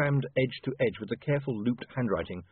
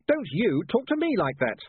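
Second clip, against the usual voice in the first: louder, higher angry retort; 9 semitones higher, 4 dB louder than usual. A narrator's reading. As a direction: fire it back, stressing me. Repeated line, glaring quarrel.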